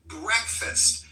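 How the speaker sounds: Breakfast said as BREK-fest, two syllables, stress on the first; clear breakfast demo. Shown as a demonstration. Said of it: "We say it as breakfast".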